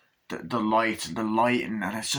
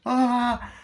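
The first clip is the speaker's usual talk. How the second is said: short high mock scream; a quick imitated shriek of horror; startled scream; 12 semitones higher, 4 dB louder than usual. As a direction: yelp in fright. Wendy sees the bear costume.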